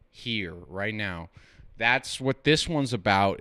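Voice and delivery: ordinary delivery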